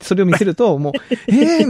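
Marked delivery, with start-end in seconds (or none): 1.3-1.7 amazed. Retold or imitated. Imagined neighbour amazed by a video.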